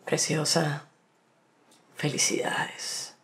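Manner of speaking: slow, hushed flirtatious compliment; romantic, festive catcaller. Acted. Woman mimics romantic men congratulating her, hushed mock catcall. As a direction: let it trail off nearly into a whisper. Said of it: "Los más románticos y festivos".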